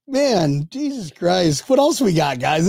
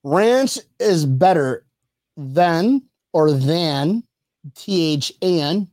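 slow mock reading; slower than usual. Shown as a demonstration. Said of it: "let's break this one down for Kelly".

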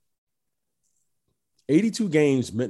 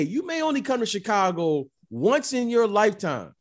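usual speaking voice

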